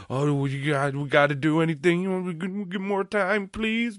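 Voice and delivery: very high, hammed-up plea rising to the end; high pleading voice. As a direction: plead in a put-on high-pitched voice.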